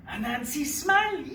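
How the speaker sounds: ordinary delivery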